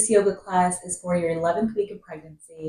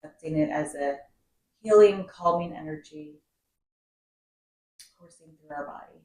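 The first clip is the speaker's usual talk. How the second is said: guided meditation. Acted. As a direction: guide the breath calmly, drawing out key words and letting phrases settle. Leads a seated breathing meditation.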